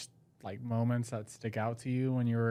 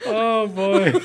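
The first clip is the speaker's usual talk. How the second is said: laughing it out; high, loud laughter; 11 semitones higher, 11 dB louder than usual. Real, not acted. Laughing after dog story.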